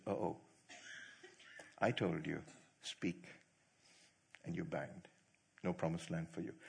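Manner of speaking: low, soft stern voice of God; God's verdict. Retold or imitated. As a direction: hand down the sentence quietly and gravely. God pronouncing judgement on Moses.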